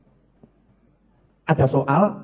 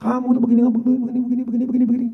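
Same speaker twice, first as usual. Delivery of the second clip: higher-pitched, monotone nagging stream; mock angry wife; 4 semitones higher, 3 dB quieter, faster than usual. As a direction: run on like a nagging wife, mildly. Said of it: "tiba-tiba istri marah-marah". (omelan istri yang pura-pura marah)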